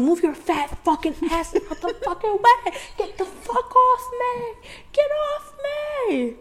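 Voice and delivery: high, strained retold yelling at the pusher; mock furious yell. Telling a friend how she yelled at the pushy girl, high mock rage. Retold shoving fight at concert.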